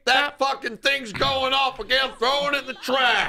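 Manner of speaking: high mock-annoyed dad; grumpy parent. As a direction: play the fed-up parent, hammed up. Acting an annoyed parent at night.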